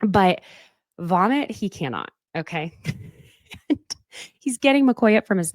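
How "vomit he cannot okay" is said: ordinary delivery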